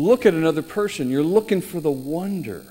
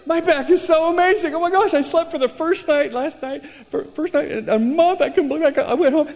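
high, bubbly, overjoyed voice; gushing waitress; 11 semitones higher, 3 dB louder than usual. Retold or imitated. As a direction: gush about the healed back. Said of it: "She says".

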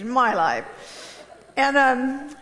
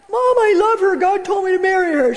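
high, louder pleading husband; pleading son; 6 semitones higher, 5 dB louder than usual. Retold or imitated. Son insisting to his mother, raised pleading voice. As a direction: insist to mom, a little loud. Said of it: "husband pleading with mother".

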